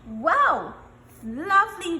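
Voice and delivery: loud delighted "Wow! Lovely,"; excited wow. Real, not acted. Teacher delighted by a pupil's answer. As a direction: burst out with praise.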